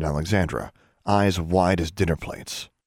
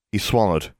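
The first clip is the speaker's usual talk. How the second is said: plain reading voice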